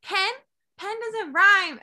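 high, slow mock disbelief; disputing retort. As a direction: imitate a friend slowly rejecting the rhyme. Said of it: "He was like".

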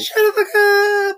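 high, slow crying parody of her shout; mock wailing imitation. Cohost parrots her swearing in a high fake cry. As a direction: squeal it back like a mocking echo.

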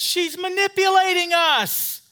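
high, loud scornful exclamation; exposing her with outrage; indignant retort. Retold or imitated. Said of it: "I said".

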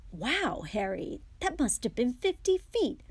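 high, impressed cheer; excited. Acted. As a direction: marvel at the throw. Twin impressed by gnome throw.

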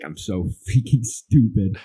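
slow, laughing self-mockery; mock self-scolding with a laugh; laughing at himself. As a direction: chuckle through the words, slowly. Self-mocking retold frustration.

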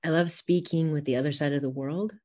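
normal talking voice

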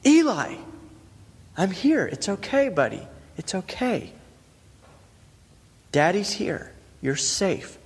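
put-on high, gentle comforting to a crying boy; soothing a scared child. Father soothing his son after a nightmare, gently. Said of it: "lifting Eli's head when he's screaming".

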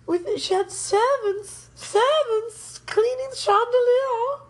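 high, slow mock-posh Hepburn lilt; posh aunt imitation. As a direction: lift it into a grand old-lady accent. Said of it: "She kinda sounded like Catherine Hepburn".

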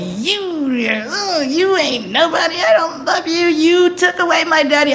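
a teen's tearful mock yell; bratty outburst. Daughter blaming her mom, high bratty crying yell. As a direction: hurl it like a hurt kid. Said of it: "I was an asshole".

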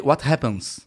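low-pitched interrupting correction; correcting someone. Retold or imitated. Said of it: "ele fica me corrigindo".

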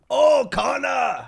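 high, drawn-out holler; excited street heckle, long and loud; acted fan shout. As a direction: call out to a star across the street. Fan yelling at fighter in street.

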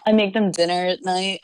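usual speaking voice

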